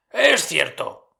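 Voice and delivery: lento y algo más alto, ligeramente marcado; admítelo despacio, con pesar; Scrooge conmovido. A narrator's reading. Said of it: "contestó Scrooge". (Scrooge asiente al espectro, lento y grave)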